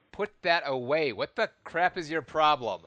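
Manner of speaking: loud furious yelling; angry shout. Acted. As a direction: bark it at her with fury. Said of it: "shout at her".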